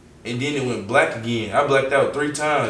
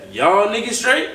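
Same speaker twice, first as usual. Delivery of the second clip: high, loud call from down the street; imitated distant yell, louder and higher; yelling back; 10 semitones higher, 5 dB louder than usual. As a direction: holler it back, pitch climbing. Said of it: "They yell back".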